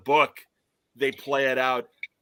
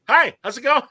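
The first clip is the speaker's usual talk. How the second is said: higher and louder, breezy greeting; cheery Jake; 10 semitones higher, 8 dB louder than usual. Retold or imitated. Jake interrupting Nog's simulation, mock cheery hello. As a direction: pop in all casual and bright-eyed. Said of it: "and he's like".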